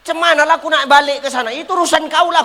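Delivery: man snapping back at the grandmother; snappy retort. Retold or imitated. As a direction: toss it back at her, not my problem. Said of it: "aku bilang".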